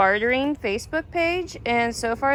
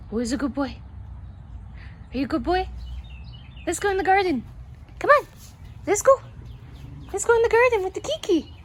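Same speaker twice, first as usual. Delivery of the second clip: high sing-song pet voice, rising higher; talking to a dog; 10 semitones higher, faster than usual. Real, not acted. Greeting her dog, heading to garden.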